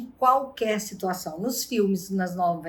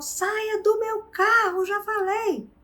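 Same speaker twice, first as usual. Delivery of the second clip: much higher, slow, deliberate; final angry order; 12 semitones higher, slower than usual. Retold or imitated. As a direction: spell out the last warning. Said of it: "e ele falou". (ordem final irritada, aguda e lenta)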